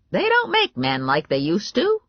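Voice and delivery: high grandma voice; blunt grandma. A narrator's reading. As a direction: butt in like an outspoken granny.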